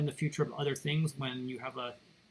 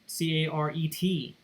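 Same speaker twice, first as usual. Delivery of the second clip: slow, letter-by-letter spelling; each letter given its own beat; spelled out; slower than usual. Shown as a demonstration. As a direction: spell it out slowly for the class. Spelling out the button name.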